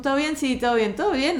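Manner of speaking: playful greeting quotes; lively greeting dialogue. Retold or imitated. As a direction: act out a chirpy back-and-forth. Acted greeting exchange.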